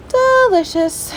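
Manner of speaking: a playful, sing-song "Delicious"; mock delighted. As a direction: stretch "Delicious" slowly up high in mock delight. Savoring food, drawn out.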